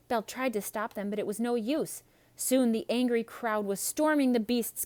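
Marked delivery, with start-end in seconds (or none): none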